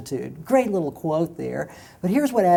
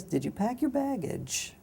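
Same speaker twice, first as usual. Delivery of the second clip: softer and slower, loaded question; pointed quiet question; 7 semitones higher, 7 dB quieter, slower than usual. Retold or imitated. Speaker to woman on plane, slow, soft and knowing. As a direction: let the question sink in slowly.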